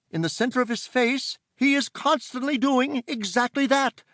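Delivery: prim, scolding auntie; stern aunt voice. Acted. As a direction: order them out at once. Aunt explains creature.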